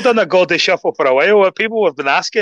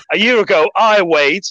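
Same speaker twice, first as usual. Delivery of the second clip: put-on slow, higher-pitched English accent; measured, raised English-accented delivery; 4 semitones higher than usual. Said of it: "where this English accent's coming from".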